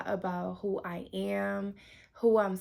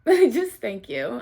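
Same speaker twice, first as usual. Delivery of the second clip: slow, louder thanks through a laugh; laughing, emphatic thanks; 2 semitones higher, 8 dB louder, slower than usual. Real, not acted. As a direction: laugh and really lean on the thanks.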